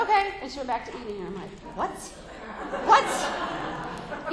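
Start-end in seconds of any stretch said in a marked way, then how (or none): 0.0-0.3 breezy daughter. Retold or imitated. Daughter's casual reply. 1.8-4.3 higher, louder double take with a laugh; an incredulous "what" through laughter; stunned disbelief. Retold or imitated. Mother at the dinner table, stunned by her daughter's shrug, laughing.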